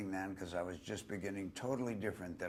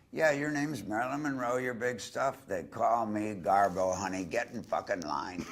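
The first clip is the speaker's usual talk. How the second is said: louder, tough movie-star sass; Garbo voice; 3 semitones higher, 7 dB louder than usual. Retold or imitated. [name] imagines Garbo telling Marilyn to wait her turn, loud and tough. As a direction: brush off a rival star with swagger.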